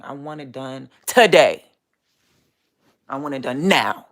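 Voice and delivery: loud, insistent, fed-up demand; frustrated outburst. Real, not acted. Vlogger venting about her distractions, loud frustrated demand. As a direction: blurt it out, done waiting.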